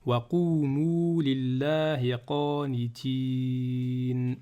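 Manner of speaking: very slow, sustained verse reading; Quran recitation. Shown as a demonstration. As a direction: hold the last syllable long and level.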